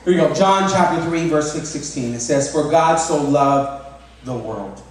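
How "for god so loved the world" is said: very slow, weighty "For God so loved the world"; solemn slow quote; slow scripture reading. Shown as a demonstration. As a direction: read the verse word by word, leaning on "God". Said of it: "Think about that".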